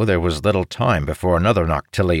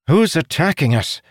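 demanding; 9 semitones higher than usual. A narrator's reading. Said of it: "Kydal demanded".

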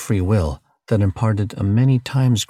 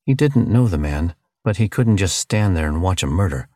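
ordinary delivery

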